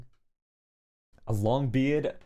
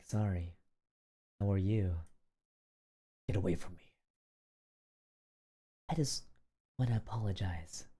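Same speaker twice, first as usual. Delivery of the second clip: quiet menace; 5 semitones lower, 7 dB quieter than usual. Retold or imitated.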